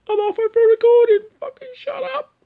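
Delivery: high-pitched, loud mock outburst; hammed-up falsetto protest, falling away at the end; exasperated yell back. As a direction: yell back at mum, fed up. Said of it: "I'm like going".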